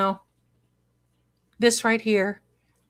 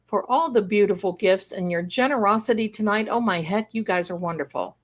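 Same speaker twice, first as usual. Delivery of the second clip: ordinary delivery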